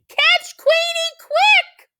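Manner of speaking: very high, loud duck squawk; quacking duck. Acted. Duck spotting stolen quilt, loud quacking voice. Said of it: "quacks Dottie".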